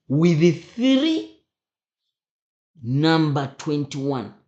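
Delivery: slow, loud, drawn-out "three"; emphatic teaching. Shown as a demonstration. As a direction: lean hard on "three", then spell out "twenty-one". Teacher stresses the required number.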